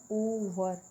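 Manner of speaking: "ओवर" with its first O held long; stretched opening O. Shown as a demonstration. Teacher points out the starting sound of ओवर, stretched O. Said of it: "इसके तो starting में ही आ रहा है".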